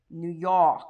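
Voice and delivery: "New York" as a local says it, Noo Yawk; New York accent. Shown as a demonstration. Host reading a viewer's question to comic, thick New York accent. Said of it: "you don't have an accent".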